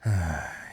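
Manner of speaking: heavy voiced sigh, falling away; weary sigh. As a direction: let out an audible sigh. Prisoner mocks the arresting knights.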